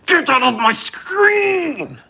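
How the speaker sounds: high, drawn-out shrieking demand; outraged shriek. Real, not acted. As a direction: shriek it slowly, losing it completely. Streamer banning an onscreen gift.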